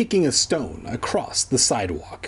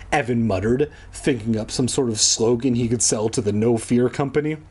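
ordinary delivery

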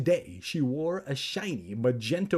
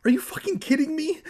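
high, fast, loud outburst; rapid squealed protest; outraged cry; 6 dB louder, faster than usual. A narrator's reading. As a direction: cry out in disbelief. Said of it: "Evan cried".

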